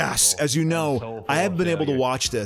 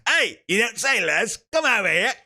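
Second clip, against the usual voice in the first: put-on East End accent; 7 semitones higher than usual. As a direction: call the lads over in East End Cockney. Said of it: "those thick East End accents".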